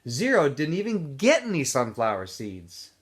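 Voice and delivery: ordinary delivery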